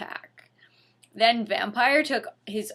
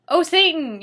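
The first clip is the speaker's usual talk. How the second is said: loud, high hammed-up yell; yell in pleasure; 7 semitones higher, 7 dB louder than usual. Harry yelling Satan's name in pleasure. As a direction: overact the pleasure. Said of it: "yelled Harry in pleasure".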